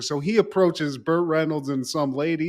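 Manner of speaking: ordinary talk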